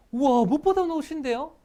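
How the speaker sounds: loud, high, thrilled wow; delighted surprise. Shown as a demonstration. Teacher shows the warm way to notice new clothes, excited. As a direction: gush with real delight. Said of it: "감정이 다르지 않아요?".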